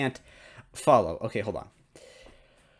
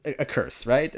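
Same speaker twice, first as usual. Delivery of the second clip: incredulous; 9 semitones higher, 4 dB louder than usual. Real, not acted. Defending a silly word.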